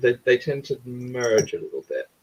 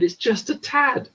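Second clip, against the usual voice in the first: exasperated, slow emphasis on "tad"; pointed, higher-pitched insistence on "tad"; exasperated correction; 12 semitones higher, slower than usual. Real, not acted. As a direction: drive home "tad" as the final word. Exasperated correction of American misuse.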